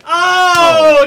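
loud, high triumphant oh; excited shout. Real, not acted. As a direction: whoop at the lucky draw. Draws number one.

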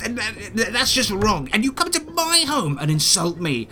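high, indignant spider voice with stammering outrage; offended spider. Acted. Giant spider scolding the adventurers who insulted him, indignant and high.

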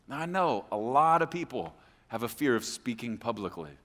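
ordinary delivery

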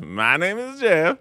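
slow, high fake Spanish accent; Channing Tatum Spanish accent. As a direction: put on a clumsy Spanish accent. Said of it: "attempting to do a Spanish".

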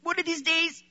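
put-on party girl telling friends she'll live for Jesus, earnestly; mock earnest vow. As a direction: sound sincere about quitting someday. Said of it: "and I'd say".

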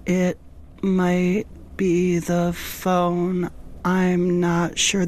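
very slow, dragged out; super slow motion. Shown as a demonstration. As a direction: stretch every word out slowly. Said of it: "Let me, let me show you".

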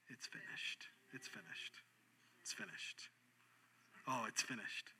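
breathless whisper, fading; a hushed, dying whisper; whispered it's finished. Acted. Jesus's last words, whispered.

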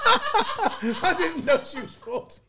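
slow, high, laughing through the words; laughing so hard the words stretch out; laughing protest. Real, not acted. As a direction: protest while cracking up.

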